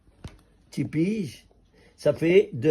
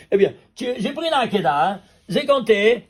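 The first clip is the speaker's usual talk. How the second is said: higher and louder, laughing with excitement; excited discovery; 8 semitones higher, 5 dB louder than usual. Real, not acted. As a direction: share the find with rising glee. Excited reveal of gematria discovery.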